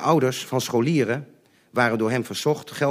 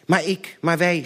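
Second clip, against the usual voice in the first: stammering; 8 semitones higher, 3 dB louder than usual. Retold or imitated. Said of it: "stamelde mijn moeder".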